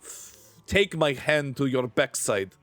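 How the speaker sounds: mock threat in a Russian accent. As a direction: play a stern Russian scolder. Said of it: "Why am I still doing the Russian accent?".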